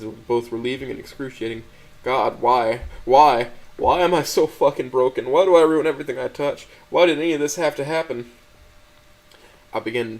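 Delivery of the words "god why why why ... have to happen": loud, desperate, despairing shouts; crying out at himself in despair; loud anguished wail. A narrator's reading. Narrator breaking down in despair.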